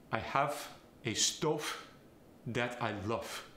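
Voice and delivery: stove said stof, the V turned to F; Farsi accent. Shown as a demonstration. Coach demonstrating a Farsi accent, a stof that I lof. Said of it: "Turn V's into F's".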